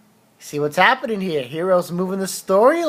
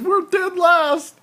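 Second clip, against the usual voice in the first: higher, slower put-on voice; mock glum admission; 14 semitones higher, 5 dB louder, slower than usual. Character tells Natsu the guild is dead last, slow and high. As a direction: drag out the bad news in a high character voice.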